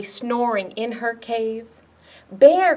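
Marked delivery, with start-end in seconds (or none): 2.3-2.8 loud, higher call; crying out. A narrator's reading. Ladybug to Bear asleep in her cave, crying out. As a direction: call sharply to rouse a sleeper. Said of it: "cried Ladybug".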